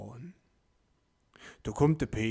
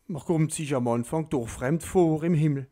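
ordinary delivery, nothing marked

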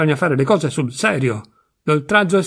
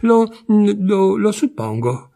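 falter, then get the answer out; disconcerted; 6 semitones higher, much slower than usual. A narrator's reading. Wife caught out by the husband's question, disconcerted. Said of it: "un po' sconcertata alla domanda".

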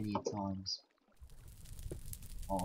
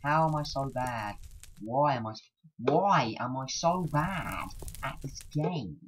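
high, loud wail at being so bad; loud exasperated despair; 5 semitones higher, 9 dB louder than usual. Real, not acted. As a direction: wail in exasperation at your own failure. Despairs at failing the game.

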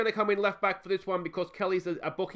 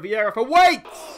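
drawn-out pun call; 5 semitones higher, 11 dB louder, slower than usual. Real, not acted.

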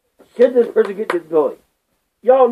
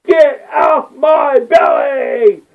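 horrified shout; 3 semitones higher, much slower than usual. Acted. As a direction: yell it like something landed on you. Horrified reaction to the video.

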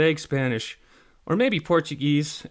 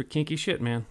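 ordinary delivery, nothing marked

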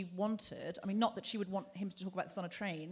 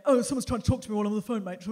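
fast, louder sneering aside; mocking dismissive bloke; 7 dB louder, faster than usual. Aggressive man on the phone mocking her, fast. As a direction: brush her off quickly. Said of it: "And he went".

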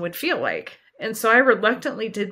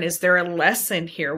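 ordinary delivery

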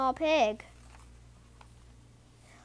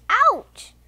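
sudden high, loud yelp; storybook cry of pain; 10 semitones higher, 7 dB louder than usual. A narrator's reading. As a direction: yelp as if bumped on the nose.

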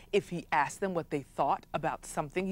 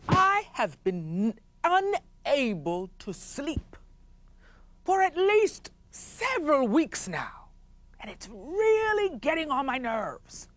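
weary chief complaining; 8 semitones higher, slower than usual. Acted. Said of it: "And the chief said".

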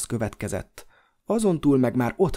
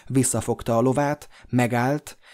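plain reading voice

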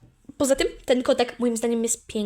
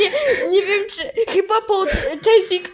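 laughing; 6 semitones higher, 4 dB louder, slower than usual. Real, not acted. Giggling about [name] music.

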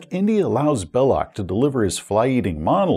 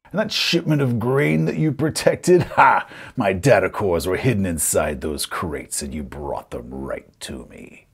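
gloating villain; 6 semitones lower than usual. Acted. Villain boasts of tricking the players, low gloating. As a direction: build to a "Ha!", then gloat low.

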